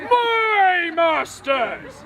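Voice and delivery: long, drawn-out proclamation; indignant call. Acted. Manservant rebuking noisy revellers, drawn-out indignant call. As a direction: stretch each word right out, grandly.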